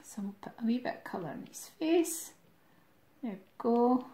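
fast whispered aside while drawing. Real, not acted. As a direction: murmur it to yourself, quick.

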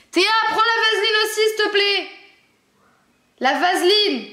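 high, loud call across the house. Real, not acted. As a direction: shout up to someone upstairs. Calling sister to bring vaseline.

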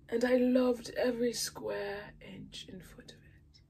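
breathy whispered confession of love. Real, not acted. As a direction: whisper it like a secret delight.

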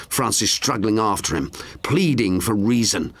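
ordinary delivery, nothing marked

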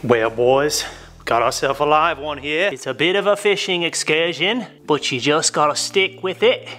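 Australian drifting into English, hammed up; a jokey outback fisherman in a wobbly Australian accent; mock Australian accent. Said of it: "I don't know what accent that was. I was trying to do Australian".

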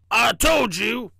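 slow, loud gruff little train; gruff storybook train voice; small gruff voice. A narrator's reading. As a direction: rasp it out slowly and emphatically. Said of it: "small, gruff voice".

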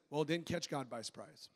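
soft, low, tactful understatement; politely restrained, quietly pointed; nice pastor reply. Retold or imitated. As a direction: say it gently and diplomatically, under your voice. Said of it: "It's my nice way of saying".

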